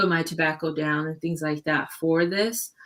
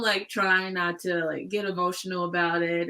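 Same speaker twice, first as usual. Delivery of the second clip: ordinary talk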